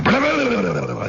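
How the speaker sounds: high burbling flutter, falling away; imitated flurry of beating wings; tongue-fluttered cockfight noise; wing-flapping noise. Imitates birds flapping in fight.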